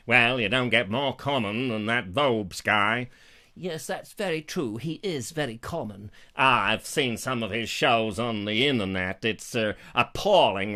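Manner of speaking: lightly voiced sneering toff, slow; snobbish royal. Royal sneering about Vobes, lightly snobbish. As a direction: look down your nose at the riffraff.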